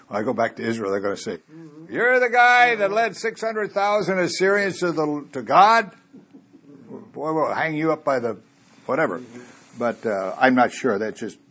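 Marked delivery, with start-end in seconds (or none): 1.9-9.2 mock angry countrymen. Preacher voices the angry Israelites.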